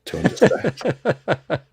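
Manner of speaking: breaks into a high laugh on the last words. Real, not acted. Joking about a hard-to-understand Irish accent, laughing.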